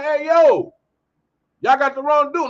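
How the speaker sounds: calling out. Retold or imitated. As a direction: holler over to get their attention. Calling out to the police.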